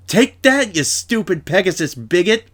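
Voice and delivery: high, loud hateful yell from below. A narrator's reading. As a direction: hurl the insult up at her. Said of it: "somebody yelled at her from below".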